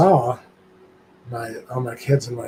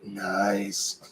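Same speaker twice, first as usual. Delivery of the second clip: lower, softer drawn-out impression; Stu; 4 semitones lower, 5 dB quieter than usual. Imitating actor's reply after squeezing a hand, low voice. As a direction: imitate him low and understated. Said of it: "his best Stu voice is like".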